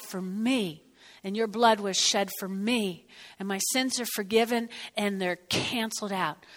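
soft, hushed prayer voice; low, soft thankful prayer; quiet prayer. Real, not acted. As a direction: pray gently under your breath. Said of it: "Real quiet".

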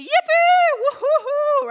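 very high, loud whooping cheer; mock elation. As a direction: whoop as if wildly overjoyed. Imagined overjoyed reaction to healing news.

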